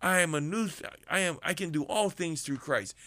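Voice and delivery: normal talking voice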